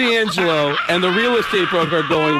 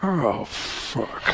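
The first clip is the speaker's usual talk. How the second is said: Pacino impression; 4 semitones lower, 8 dB quieter than usual. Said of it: "the Pacino looked and went".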